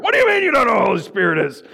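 imagined harsh Paul scolding the ignorant believers; mock harsh rebuke. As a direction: imitate Paul tearing into them. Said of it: "write some harsh stuff".

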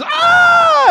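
high, loud shriek of fright; sudden high-pitched scream; terrified scream. Acted. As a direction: scream out at seeing a ghost. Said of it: "He screams".

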